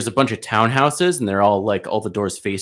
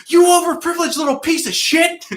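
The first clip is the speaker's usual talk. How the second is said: high-pitched furious yell; 3 dB louder than usual. Retold or imitated. Pizza driver yelling at kid blocking the car, shrieking furiously. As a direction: scream the insult up high. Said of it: "I just went off, started yelling".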